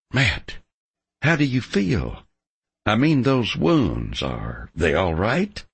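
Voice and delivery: a concerned young woman, gently voiced; lightly voiced young woman. A narrator's reading. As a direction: ask with worried care. Female character voiced.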